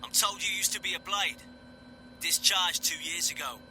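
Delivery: high Cockney soldier voice; clipped Cockney regiment lad; Cockney accent. Acted. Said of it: "he said in a Cockney accent".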